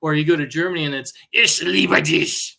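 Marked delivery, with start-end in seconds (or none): 1.3-2.6 slow, mock-menacing German declaration; harsh German. How Germany says love, slow harsh mock-German. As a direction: make love sound like a stern order.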